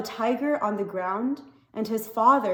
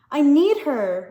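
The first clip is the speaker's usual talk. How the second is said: pleading; 4 semitones higher, 7 dB louder than usual. Acted. Boy crying to father.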